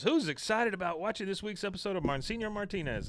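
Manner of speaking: lightly voiced eager teacher; a hint of a bubbly schoolteacher; [name] to the class.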